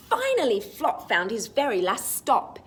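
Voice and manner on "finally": usual speaking voice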